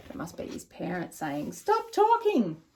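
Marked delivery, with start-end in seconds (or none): 1.5-2.6 high, loud, slow scolding; stern parent shout. Acted. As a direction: bark the order across the house.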